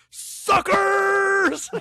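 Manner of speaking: high, far-off shouted Suckers; Scorpion voice. Retold or imitated. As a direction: yell it like a cheesy ninja villain. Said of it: "in this Scorpion voice".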